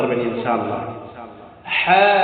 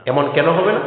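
usual speaking voice

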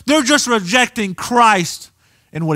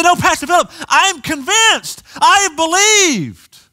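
mock protesting believer; 10 semitones higher than usual. Pastor voices a protesting believer.

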